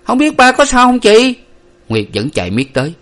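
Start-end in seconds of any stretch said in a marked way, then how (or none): 0.0-1.3 high, loud anxious question; urgent child's cry; worried boy running. A narrator's reading. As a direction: call to your sister while running. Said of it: "vừa chạy vừa hỏi".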